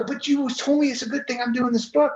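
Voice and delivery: high-pitched, baffled objection; retold incredulous protest.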